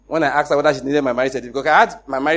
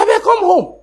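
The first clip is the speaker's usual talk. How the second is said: much higher, louder plea to come back; urgent call home; 6 dB louder than usual. Retold or imitated. Husband on the phone urging his denied wife home, loud high plea. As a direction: call her home over the phone, insisting.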